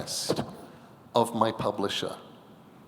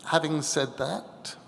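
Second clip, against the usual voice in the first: usual speaking voice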